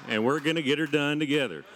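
put-on folksy Southern-accented Jesus. Jesus reassuring Peter about Satan in Southern accent. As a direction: play Jesus as a Southern good old boy. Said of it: "he's from Virginia or Arkansas".

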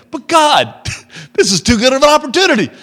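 high, loud, fast laughing protest; arguing with God over a tempting chance; mock eager protest. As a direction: plead eagerly through laughter.

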